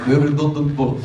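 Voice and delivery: imitating a heavy Russian accent; hammed-up Russian accent for laughs; mock Russian accent. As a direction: repeat it in a put-on Russian accent. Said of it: "your heavily Russian accent".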